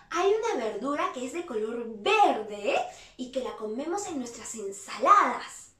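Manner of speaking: ordinary talk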